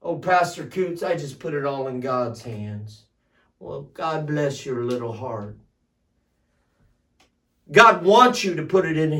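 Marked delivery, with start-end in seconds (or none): 0.0-3.1 low, soft put-on voice; mock passive churchgoer. Church member excusing inaction to the pastor, soft mock piety. 3.6-5.6 very soft, low condescending pity; mock sympathy. Pastor answering a passive member, hushed mock sympathy.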